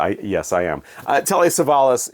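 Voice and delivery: ordinary delivery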